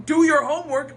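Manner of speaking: loud, blunt mock command; bossy parent. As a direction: order it like a strict parent. Acting a bossy parent's order.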